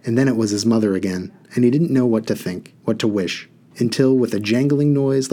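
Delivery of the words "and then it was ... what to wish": ordinary delivery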